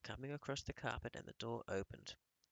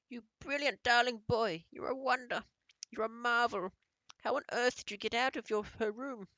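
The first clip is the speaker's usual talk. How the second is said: delighted grandmother; 12 semitones higher, 8 dB louder than usual. A narrator's reading. Grandmother praising the boy.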